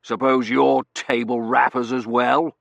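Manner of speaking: loud, slow, suspicious challenge; gruffly emphatic colonel; glaring colonel. A narrator's reading. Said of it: "The Colonel glared".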